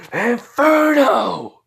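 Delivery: huge drawn-out high battle cry; battle yell. Acted. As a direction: scream the name at full power. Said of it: "I just yell out".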